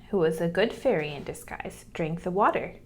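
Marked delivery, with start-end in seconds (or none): none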